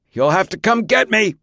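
high-pitched yell from inside; defiant call. A narrator's reading. Defiant call from inside burning trailer.

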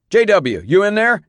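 loud, high call through a door. A narrator's reading. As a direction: call to someone inside. Officer calling through trailer door.

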